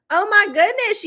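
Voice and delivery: "Oh, my goodness" jumps up high, stressing "my"; sudden high sympathetic gasp of surprise; surprised. Real, not acted. Host hears a viewer lost power, high surprised exclamation.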